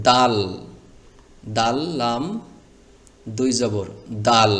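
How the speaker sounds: দাল, লাম spelled out with a strong held দাল; correct spelling out. Shown as a demonstration. Teacher spells the word the right way for students. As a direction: spell it out letter by letter, firmly. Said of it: "আপনাকে বলতে হবে এভাবে".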